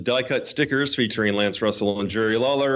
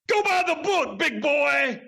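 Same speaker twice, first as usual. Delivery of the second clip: much higher, hammed-up put-on Doctor D voice; quoting Doctor D. Host retells Doctor D's answer to his question, much higher mock voice. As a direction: play Doctor D's brush-off as a high, jokey caricature. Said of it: "What did he say?".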